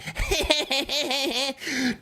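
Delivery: high laugh at her words; high mocking laugh. Real, not acted. Mocking her phrase, high laugh.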